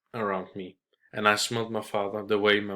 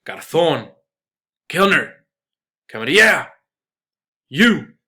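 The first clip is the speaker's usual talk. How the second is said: shouted much higher and much louder, each call rising; loud shouting; 6 semitones higher, 12 dB louder than usual. Acted. Father calling the waiter back for more drinks, loud shout. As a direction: bang the glass and bellow for service. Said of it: "began shouting again".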